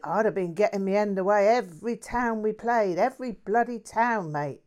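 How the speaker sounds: a mouthy London bloke bragging; lightly voiced cheeky Cockney; Cockney mate. Jack's boastful chat.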